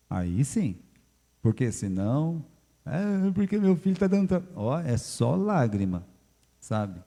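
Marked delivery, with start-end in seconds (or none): 2.8-4.4 high, mock-whiny complaint; lightly mocked complaining mother; whining parent. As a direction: imitate a fretting parent.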